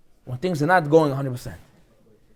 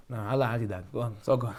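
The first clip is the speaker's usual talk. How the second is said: much lower and softer, waving him off; can't be bothered, shoo him away; dismissive brush-off; 8 semitones lower, 10 dB quieter than usual. Pretend. Teacher dismissing weak student.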